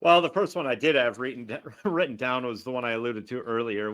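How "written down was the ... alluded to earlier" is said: ordinary talk, nothing marked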